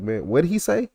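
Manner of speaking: mimicking a baffled listener, pitch jumping up. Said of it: "people be just be stuck".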